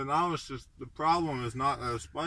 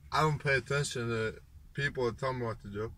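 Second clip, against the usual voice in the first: ordinary delivery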